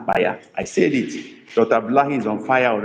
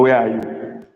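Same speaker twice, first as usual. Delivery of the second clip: usual speaking voice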